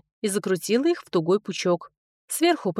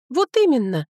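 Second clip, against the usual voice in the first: higher, louder indignant exclamation; 5 semitones higher, 5 dB louder than usual. A narrator's reading. Rider retorting about the rat, indignant. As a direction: throw it back in outrage. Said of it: "возмутилась я".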